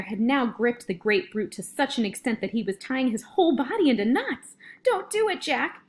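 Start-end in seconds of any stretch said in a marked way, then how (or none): 4.8-5.8 high-pitched frightened plea; panicked screech. A narrator's reading. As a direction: screech for him to stop. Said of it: "he screeched".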